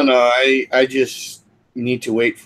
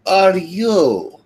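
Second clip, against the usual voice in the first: high, loud, drawn-out question; startled demand; 8 semitones higher, 5 dB louder, much slower than usual. Acted. Startled character picked up by stranger.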